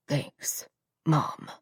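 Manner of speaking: mild choked-up murmur; swallowing the lump in his throat; choked thanks. Acted. Son thanking his dying mother, choked. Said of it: "choke the words past the lump".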